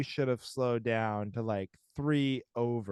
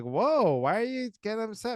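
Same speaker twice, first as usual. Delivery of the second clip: higher-pitched mock defensiveness; imitated husband's protest, pitched up; retold defensive husband; 9 semitones higher than usual. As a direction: act out your own startled side of a spat. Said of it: "'Cause I'll be like".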